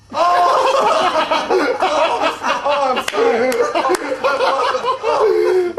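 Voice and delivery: bursting out laughing, loud and high; can't stop laughing at the splash; laughing apology between giggles; real laughter. Real, not acted. Teacher cracks up at splash.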